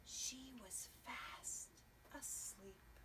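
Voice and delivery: whispered sleepy line. Acted. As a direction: whisper so she won't wake. Squirrel sleeping, hush.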